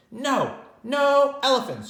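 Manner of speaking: high, loud, slow no no; Grandma turns the pet man away with a high shout; shouted refusal. Acted. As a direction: shout it down the doorstep. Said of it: "shouted Grandma".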